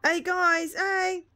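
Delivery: high, loud shout to his mates; excited drunk call. Acted. Drunk superhero calling his friends over, loud and high. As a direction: yell across the alley, thrilled.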